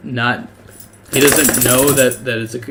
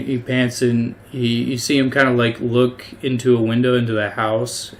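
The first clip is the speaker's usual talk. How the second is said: ordinary delivery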